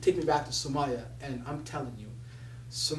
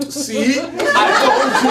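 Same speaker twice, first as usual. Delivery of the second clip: higher, louder, slow and laughing; laughing told-you-so; 8 semitones higher, 12 dB louder, much slower than usual. Retold or imitated. Mom gloating to her son, laughing. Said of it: "my mom's like".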